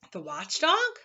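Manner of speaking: puzzled rising question; ask, baffled by the name; quizzical. Acted. Milo puzzled by the Lethargarians, asking quizzically. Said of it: "said Milo quizzically".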